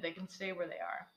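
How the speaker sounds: ordinary delivery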